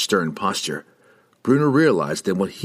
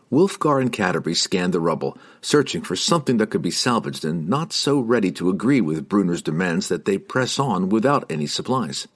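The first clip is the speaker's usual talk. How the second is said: plain reading voice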